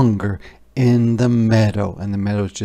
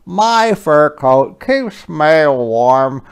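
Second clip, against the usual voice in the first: slow, louder puffing otter; 4 semitones higher, 8 dB louder, much slower than usual. Acted. Otter greets Hedgehog, slow and puffing. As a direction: huff it out like a cold otter. Otter complains of cold paws.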